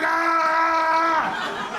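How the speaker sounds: long, high held yell; drawn-out mock scream; imitated scream. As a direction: mimic the nun crying out.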